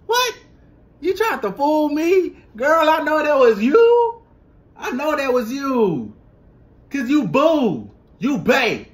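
high, loud teasing call-out to the singer; sassy call-out. Retold or imitated. Fan calling out the singer, high and sassy. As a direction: catch her out with sass. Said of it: "it's just like".

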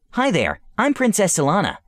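high, friendly introduction; princess voice. Acted. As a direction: greet the little creature sweetly. Introduces herself.

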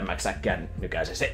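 ordinary delivery

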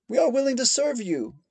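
high, loud, pleading man; a captive man begging to serve; anxious offer. A narrator's reading. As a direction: blurt out submission in fear. Frightened captive offers submission.

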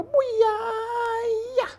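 high, drawn-out whoop; excited whoop. Shown as a demonstration. Teacher hyping up a big pencil stroke, excited whoop. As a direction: squeal it out, very high and loud. Repeats the exciting drawing move.

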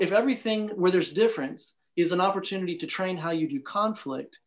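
ordinary talk, nothing marked

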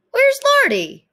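high, loud searching call. Acted. Looking for Lardy, calling him loudly. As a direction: shout for him across the house.

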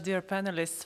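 usual speaking voice

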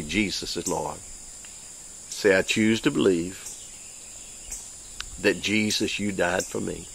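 soft, slow prayer for the congregation to repeat; gentle repeat-after-me prayer; led prayer. Acted. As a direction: pray it quietly and sincerely. Leads prayer.

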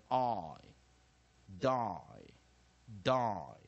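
"I" and "die" drawn out with a long I; long vowel I. Shown as a demonstration. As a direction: model "die" with a full long I sound, falling. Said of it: "所以I变成拉成长母音".

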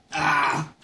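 short pained cry from spice. Real, not acted. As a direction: cry out at the burn. Pepper burning mouth.

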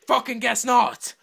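slow, loud furious snarl; loud slowed-down outburst; angry yell. Real, not acted. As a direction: bellow it at the traitor. Player gets attacked, yells.

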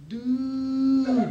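mock surfer drawl of a cow; a laid-back stoner cow's one word; lightly voiced surfer-dude cow; California cow. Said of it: "Your average California cow".

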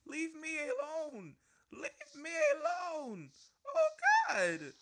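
very high, loud, slow whimpering wail; drawn-out hammed-up sob; mock crying. Real, not acted. Attacked in game, whining.

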